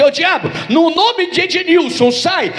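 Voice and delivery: high-pitched commanding rebuke; mock exorcist. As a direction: order a demon out in mock earnest. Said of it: "Se eu for falar assim".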